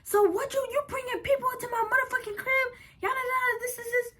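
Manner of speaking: high, fast, tearful rant; furious tearful rant. Retold or imitated. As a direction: mimic her ranting through tears. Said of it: "she was like".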